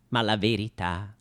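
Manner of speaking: ordinary talk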